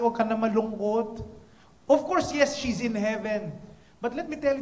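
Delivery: ordinary talk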